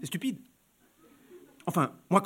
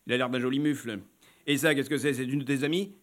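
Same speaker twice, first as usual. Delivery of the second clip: low man; 6 semitones lower, 3 dB quieter than usual. Acted. Client's voice.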